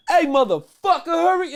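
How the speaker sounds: high, loud mock shout; angry customer yell. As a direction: bark at him to hurry. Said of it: "You know".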